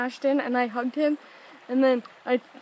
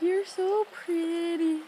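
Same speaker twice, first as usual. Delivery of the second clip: higher, very slow imitation; drawn-out compliment; 5 semitones higher, much slower than usual. Teller mimics Ashton gazing at her, slow admiring voice. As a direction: stretch out each word of the retold compliment.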